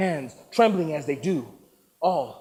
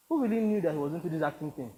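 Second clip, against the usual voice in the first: ordinary delivery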